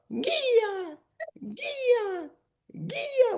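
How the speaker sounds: high, drawn-out calling of Gia. Retold or imitated. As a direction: call Gia across the field, high. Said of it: "heard my name in the background".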